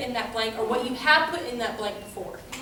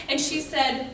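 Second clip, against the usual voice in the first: normal talking voice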